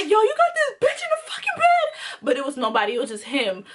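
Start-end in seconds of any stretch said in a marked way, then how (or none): 0.0-2.2 very high-pitched outraged screech; retold fighting yell. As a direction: explode into a high accusation, acted out. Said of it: "I'm ready to start fighting".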